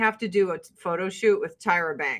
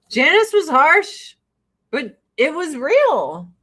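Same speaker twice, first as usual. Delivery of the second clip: loud; 5 semitones higher, 5 dB louder than usual. Pretend. Mock Janice impression line.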